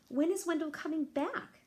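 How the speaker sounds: high, loud excited plea; bursting to see him again; eager question. Acted. Sophie asks her parents, high and excited.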